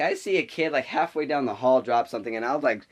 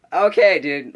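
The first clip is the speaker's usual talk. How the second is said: loud, higher "okay, dude"; exasperated reaction; 4 semitones higher, 9 dB louder, slower than usual. Retold or imitated. Classmate reacting to what she said in class, exasperated. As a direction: burst out, taken aback.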